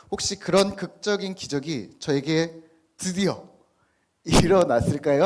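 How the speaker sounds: 일어났을까요 thrown out loud to the room; loud rhetorical question. Real, not acted. Preacher baiting the congregation with a loud question. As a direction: ask the crowd, full voice, teasing.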